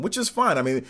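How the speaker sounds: ordinary delivery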